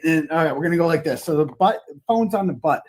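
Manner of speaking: ordinary talk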